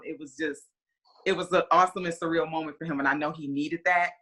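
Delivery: normal talking voice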